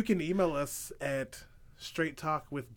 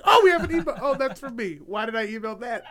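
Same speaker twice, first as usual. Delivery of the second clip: laughing self-quote; 7 semitones higher, 6 dB louder, faster than usual. Retold or imitated. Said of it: "I was like".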